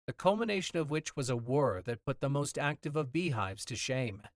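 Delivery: usual speaking voice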